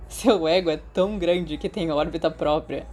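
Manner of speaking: lightly voiced teasing friend; a playful dig, mock serious; teasing jab. Remus teasing Sirius about his ego, playfully.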